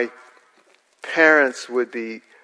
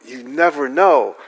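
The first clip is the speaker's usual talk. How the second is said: ordinary talk, nothing marked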